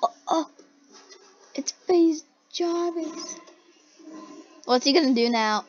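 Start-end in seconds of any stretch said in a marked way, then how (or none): 0.0-3.4 mock player reaction. Viewer voicing player spotting FaZe Jarvis, high mock voice. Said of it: "He's like".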